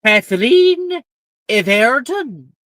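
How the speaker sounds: high, loud roll-call, drawn out at the end; calling out a name. A narrator's reading. Headmistress calls the next first year forward, loud announcement. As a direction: summon the next student across the hall. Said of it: "Another notable name follows".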